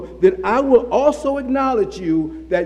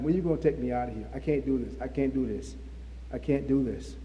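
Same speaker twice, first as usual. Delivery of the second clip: weary pleading Job; 7 semitones lower, 10 dB quieter, faster than usual. Acted. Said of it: "if this is Job saying to God".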